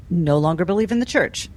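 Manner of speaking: normal talking voice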